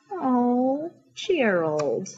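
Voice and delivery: soft, falling, sympathetic sigh of a name; quiet, consoling; tender sympathy. Acted. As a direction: comfort a sad friend gently. Pig comforts sad friend.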